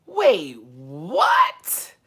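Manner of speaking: loud, incredulous double-take; loud, high-rising shock; stunned disbelief. Real, not acted. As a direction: blurt it out, stretching "what". Still stunned by the news.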